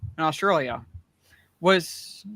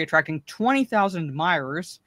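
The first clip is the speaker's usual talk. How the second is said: ordinary talk, nothing marked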